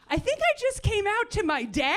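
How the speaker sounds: put-on telling girlfriend, bewildered and laughing; stunned realization. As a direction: blurt it out in disbelief.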